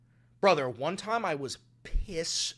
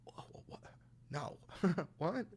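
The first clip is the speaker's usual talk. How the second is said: put-on drunk confusion; 11 dB quieter than usual. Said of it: "And I'm fucking blasted".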